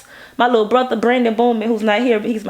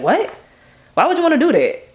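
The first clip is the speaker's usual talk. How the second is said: puzzled mom; 7 semitones higher, faster than usual. Retold or imitated. Mom questioning the video idea.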